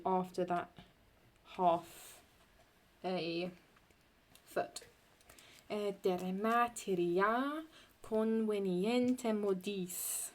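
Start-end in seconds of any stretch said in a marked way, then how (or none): 5.7-10.3 slow, measured Latin verse, each syllable weighed; scanned Latin reading. Shown as a demonstration. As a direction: read the line slowly in metre. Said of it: "reading Latin line in scansion".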